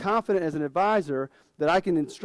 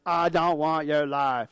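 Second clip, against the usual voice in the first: put-on slow, drawn-out Texas accent on "I don't want your life"; Texas drawl; slower than usual. Son telling his dad off in a slow Texas drawl. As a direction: drag the Texas vowels out slow, like a sullen son. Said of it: "in this Texas drawl".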